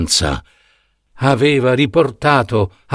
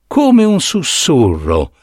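ordinary delivery

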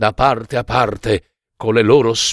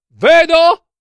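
high, loud call; excited sister; 6 dB louder than usual. A narrator's reading. Sister answers from the tower, excited call. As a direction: shout it down excitedly. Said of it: "rispose la sorella".

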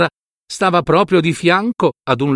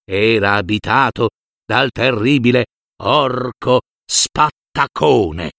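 ordinary delivery

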